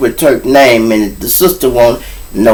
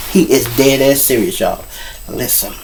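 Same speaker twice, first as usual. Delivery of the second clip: normal talking voice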